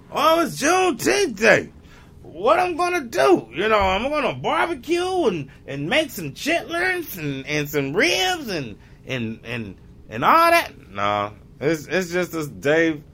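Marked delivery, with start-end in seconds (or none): 0.1-7.4 high, far-off put-on voice, much higher; mock excited celebrant. Waking up on Juneteenth, mock giddy high voice. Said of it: "I'm gonna wake up in the morning". 10.1-10.7 mock celebrant.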